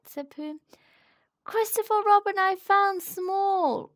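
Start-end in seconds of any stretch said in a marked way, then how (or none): 1.5-3.9 much higher, louder, slow proud announcement; triumphant high shout; excited cry. Acted. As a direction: announce the discovery to Christopher Robin. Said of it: "cried Piglet".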